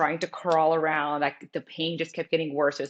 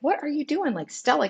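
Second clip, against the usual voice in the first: higher-pitched mock scolding; retold dog scolding; 10 semitones higher than usual. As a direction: chide a pesky little dog, voice lifted. Scolding her dog in retelling.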